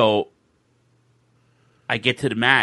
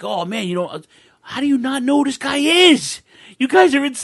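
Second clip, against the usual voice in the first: high, loud mock disbelief; incredulous fans; 11 semitones higher, 7 dB louder than usual. Fans scolding him for not knowing, high loud disbelief. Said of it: "these people were like".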